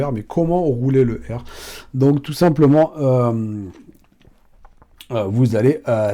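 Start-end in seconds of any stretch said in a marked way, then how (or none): none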